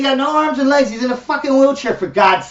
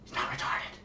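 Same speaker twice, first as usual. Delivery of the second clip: barely voiced, hushed whisper; mock whispering; 7 semitones lower, 22 dB quieter, faster than usual. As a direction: whisper it as if it's shameful. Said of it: "they're whispering".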